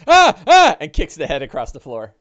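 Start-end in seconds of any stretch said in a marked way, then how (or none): 0.1-0.8 high, loud yelp of disgust; startled disgust. Acted. Bing kicking the head away with a high loud yelp. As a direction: recoil from the head, squealing. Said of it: "Bing is like".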